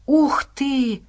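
high-pitched exclamation of wonder; exclaiming. A narrator's reading. Nastya reacts to what she hears, exclaiming. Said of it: "воскликнула Настя".

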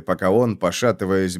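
usual speaking voice

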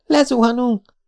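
high terrified shriek; screamed panic. A narrator's reading. Jessica screams to Spider they are crashing. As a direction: scream that you're falling. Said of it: "sikította a lány".

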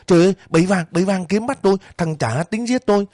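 panicked cry. A narrator's reading. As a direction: scream it out in panic, voice shooting up. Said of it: "Tôi la lên".

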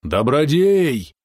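long, high, drawn-out call; straining chesty cry. A narrator's reading. Father calls his captive son from shore, straining cry. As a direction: cry the name out slowly from the chest. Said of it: "послышался крик, грудной, надсадный".

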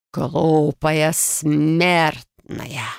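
slow, drawn-out angry snarl. A narrator's reading. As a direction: spit out the insult slowly. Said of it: "разозлился мужчина".

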